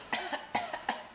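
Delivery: acted storybook whale cough. A narrator's reading. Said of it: "while coughing".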